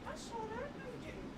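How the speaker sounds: high teasing pet voice. Real, not acted.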